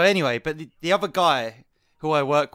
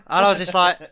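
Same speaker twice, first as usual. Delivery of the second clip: ordinary talk, nothing marked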